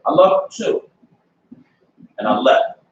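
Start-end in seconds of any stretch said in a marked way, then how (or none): 0.1-0.8 emphatic. Real, not acted.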